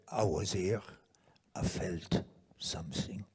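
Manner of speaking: put-on low, breath-heavy French accent, "something" as "somesing"; weird French accent. Recapping the friend's last words, in a weird French accent. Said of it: "weird French accent".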